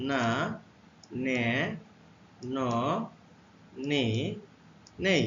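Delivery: না, নে, ন, নে, নেই said one by one, slow and clear; teacher modelling না নে ন নে নেই; letter sounds demo. Shown as a demonstration. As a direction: model না নে ন নে নেই with a pause after each. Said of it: "এন এর উচ্চারণ".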